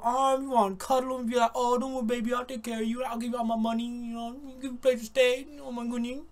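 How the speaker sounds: mock cooing man. Mocking a man falling for her tears, soft doting coo. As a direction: play a smitten guy babying her.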